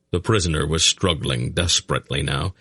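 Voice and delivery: ordinary delivery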